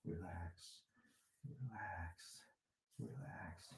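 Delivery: soft whispered relax. Shown as a demonstration. Teacher guiding a massage at the ear, whispering. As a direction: let each word fall away in a hush. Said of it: "whisper to ourselves by saying".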